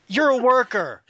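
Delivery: loud, high-pitched mock accusation; mock accusing shout. Host jokingly calls out absent Furyk, mock accusing shout. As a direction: call him out at full voice, in jest.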